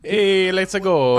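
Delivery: slow, high, loud Italian cheer; hammed-up Italian. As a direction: drag out a cartoon Italian call. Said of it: "I'm doing, like, New York".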